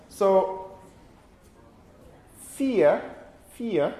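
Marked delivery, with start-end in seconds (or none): 2.4-3.9 fear as a gliding "fee-uh", no final r; British diphthong. Shown as a demonstration. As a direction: say fear the British way, ending on a schwa. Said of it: "very clear diphthong that we have in British English".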